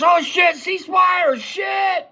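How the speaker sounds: high shouted call to stop firing; urgent shout. Retold or imitated. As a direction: yell ceasefire in alarm. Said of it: "Mike says".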